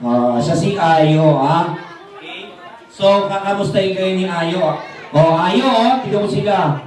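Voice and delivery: usual speaking voice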